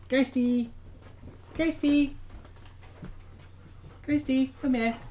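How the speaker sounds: high sing-song call to a pet; high coaxing dog call. Real, not acted. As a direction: call him from across the room. Calling the dog over.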